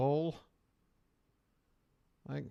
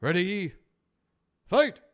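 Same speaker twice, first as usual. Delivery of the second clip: loud, higher arcade fight call; booming fighting game announcer; 5 semitones higher, 7 dB louder than usual. Pretend. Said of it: "I'm gonna double dragon this shit".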